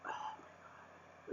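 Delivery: very soft, slow whispered groan of dismay; exasperated whisper. Real, not acted. Reviewer dreading the ending he hates, exasperated whisper. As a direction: whisper it slowly, drained.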